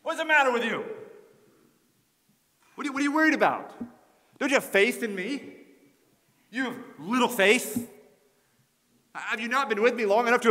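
loud, high, scornful scolding; stinging rebuke. Acted. Jesus rebuking frightened disciples, loud scornful scolding. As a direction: tear into the disciples with contempt. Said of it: "Was it a stinging rebuke?".

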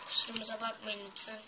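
ordinary talk, nothing marked

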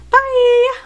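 bubbly goodbye. Real, not acted. Cheerful vlog sign-off.

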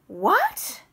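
loud, high shocked yell. Acted. Babette shouts at the brother-in-law in shock, loud high yell. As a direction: shout it out in disbelief. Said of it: "Babette yelled".

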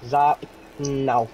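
ordinary delivery

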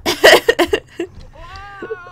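loud, high burst of laughter; laughing. Real, not acted. Player amused by own attempt to sound cool, laughing.

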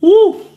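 short, loud, high whoop; sharp high "woo" at the hot food. Real, not acted. Blowing on hot sausage.

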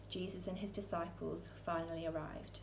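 ordinary delivery, nothing marked

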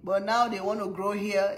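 ordinary talk, nothing marked

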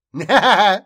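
loud, high burst of laughter; real laugh. Real, not acted. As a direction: burst out laughing after the performance. Breaks out of character laughing.

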